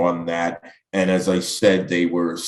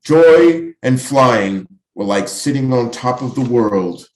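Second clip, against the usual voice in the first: higher, projected poem reading; poetry recitation; 5 semitones higher, 5 dB louder than usual. Acted. Poet reading to an audience about flying, projected recitation. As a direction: declaim it in long, deliberate phrases, dropping on the last word.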